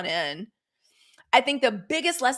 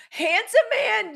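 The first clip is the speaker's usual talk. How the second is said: high slow emphasis; 13 semitones higher, 6 dB louder, slower than usual. Real, not acted. Drawn-out emphasis.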